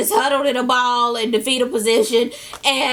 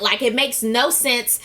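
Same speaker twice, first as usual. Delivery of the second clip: normal talking voice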